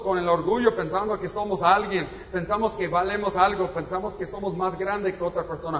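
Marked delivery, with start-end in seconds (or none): none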